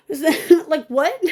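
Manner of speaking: high, laughing disbelief. Real, not acted. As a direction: laugh out the question. Laughing at commenters.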